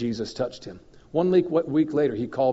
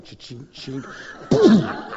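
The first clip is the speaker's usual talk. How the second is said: mock shotgun blast; 6 dB louder, much slower than usual. Mimics shotgun firing.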